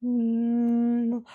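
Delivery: high, steady hum through the nose; narrow, held hum; closed hum. Shown as a demonstration. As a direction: hum once before the yawn. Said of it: "So hum".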